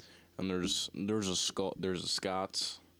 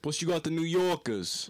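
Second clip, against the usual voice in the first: higher, louder hammed-up New Yorker; mock New York accent; 9 semitones higher, 5 dB louder than usual. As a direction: throw in a big New York twang. Listing American accents.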